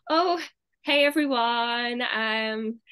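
drawn-out American streamer greeting; put-on American accent. Streamer greeting an American audience, in a put-on American accent. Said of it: "let's just speak a different accent".

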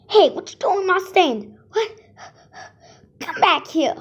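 high loud yell at a thief; angry yelling. Acted. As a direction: shout after the thief. Vendor yells at thief.

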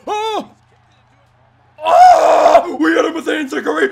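loud, high, fast cheering yell; triumphant shout. Real, not acted. Gamer cheering as his move lands, triumphant shout. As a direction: scream with joy at the big hit.

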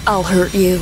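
usual speaking voice

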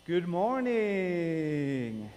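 slow, stretched good morning; drawn-out greeting. Real, not acted. Pastor greeting the congregation, slow and stretched. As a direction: greet the whole church slowly.

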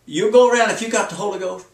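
normal talking voice

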